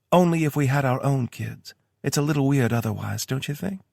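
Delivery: Tara voice. A narrator's reading. Tara's voice in story.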